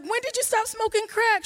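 very high, loud, eager; a squeaky, thrilled question; excited question. Retold or imitated. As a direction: ask it bursting with joy. Excited question during the celebration.

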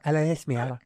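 Spanish-lisped "Alexithymia"; mock Spanish accent. Teasing how Spanish people say it, mock Spanish accent. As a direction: imitate a Spanish speaker's "Alexithymia". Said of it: "It sounds like from Spain".

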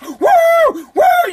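very high, loud whoops of joy; ecstatic whoop. Pretend. You're offered a million for life, whooping high and loud. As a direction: whoop like you just got rich. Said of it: "You'd be going nuts".

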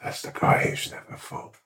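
hushed, voiceless stage whisper; secret whisper. Acted. Tipping off friend about the man just introduced, whispering. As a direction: whisper it confidentially into an ear. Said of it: "then I whisper in your ear really quietly".

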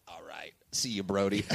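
very low, hushed mutter; subdued sign-off. Retold or imitated. Listener saying goodbye after a freestyle, low and subdued. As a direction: drop low and soft, imitating his grudging farewell. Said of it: "his scowl turns into, like".

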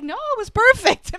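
imitated high, loud reassurance; hammed-up enthusiasm for a fellow actor; gushing praise. Retold reassurance that act was perfect.

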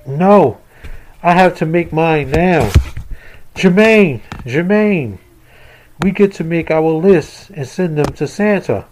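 put-on excited little boy; child voice. Boys talk about Christmas lists.

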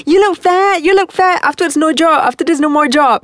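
high, taunting jeer; mean mocking man. Journalist taunting her pregnant friend, high and mean. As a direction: sneer it like a catty bully. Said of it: "Like, really mean".

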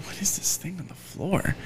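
near-whispered puzzled question; acted hushed puzzlement; hushed wondering. Acted hushed wonder while staring.

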